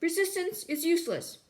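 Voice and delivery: high, loud robotic shout; guard shouting again. Acted.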